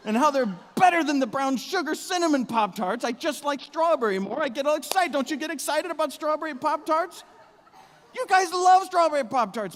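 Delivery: high, loud excited yelling; outdoor voice. Acted. Husband raving to his wife about strawberry Pop-Tarts, loud high yelling. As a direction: bellow with hammed-up Pop-Tart enthusiasm. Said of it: "you hear my voice start to rise".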